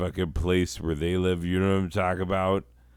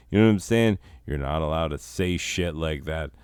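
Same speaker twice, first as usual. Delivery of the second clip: ordinary talk, nothing marked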